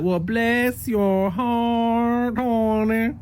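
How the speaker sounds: slow, sweet bless your heart; drawn-out syrupy Southern lady. Shown as a demonstration. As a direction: say it sweetly, meaning nothing by it. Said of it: "she doing that in a sweet way".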